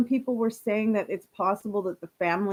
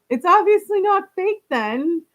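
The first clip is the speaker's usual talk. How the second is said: exasperated; 9 semitones higher, 7 dB louder than usual. Real, not acted. Reacting to GoFundMe story.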